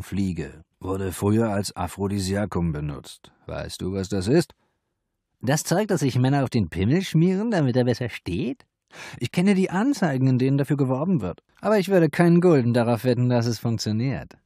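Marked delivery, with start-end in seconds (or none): 5.4-14.4 high, loud cheeky madam voice; madam scoffing at the potion; brash madam. A narrator's reading. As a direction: rattle it off, high and brazen.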